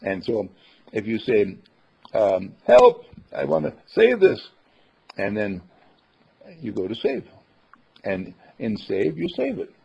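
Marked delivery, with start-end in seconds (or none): 2.7-4.5 higher, louder playful plea; mock cry for help. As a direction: call out for help, half joking. User calling for help.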